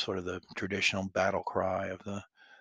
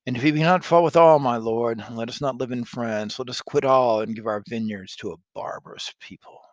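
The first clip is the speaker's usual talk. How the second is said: loud, fast furious lord; rally the council to fight; defiant nobleman; 10 dB louder than usual. Acted. Constable urges war.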